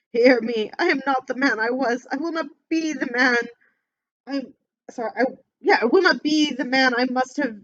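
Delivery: loud, high anguished no; desperate pleading. Acted. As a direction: shout your denial in terror. Scrooge begs at the grave.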